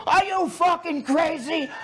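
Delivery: high pitched mock yell from the brother; retold scream. Brother on the phone after seeing him on TV, mock yelling. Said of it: "And he screamed at me".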